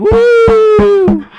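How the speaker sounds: long, very high "woo" at full voice; loud whoop. Acted. Going in a coworker's face, loud high whoop. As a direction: whoop right in someone's face, sustained and piercing. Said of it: "go in his face and be like".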